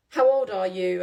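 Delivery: a hint of a loud pushy drunk; loud nosy question. Retelling the drunk man on the tube, loud and pushy. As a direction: bark the question, mildly hammed.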